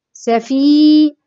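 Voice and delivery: سفينتان in two stretched halves, safiii, nataaa-ni; modelled pronunciation. Shown as a demonstration. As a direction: draw out both long vowels of سفينتان. Teacher models the dual word. (نطق سفينتان مع إطالة المدود)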